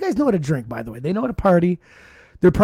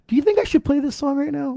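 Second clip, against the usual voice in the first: pointed question; 9 semitones higher than usual. Retold or imitated. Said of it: "I'm like".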